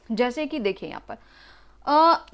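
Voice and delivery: usual speaking voice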